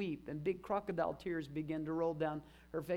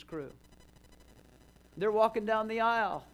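rising, louder preaching build; emphatic preaching; 3 semitones higher, 5 dB louder than usual. Acted. Pastor rallying his congregation, emphatic louder preaching. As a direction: preach it out, building each phrase.

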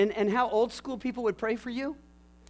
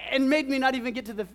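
normal talking voice

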